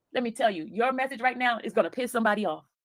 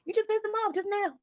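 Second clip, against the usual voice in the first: excited; 7 semitones higher than usual. Real, not acted. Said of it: "repeated higher".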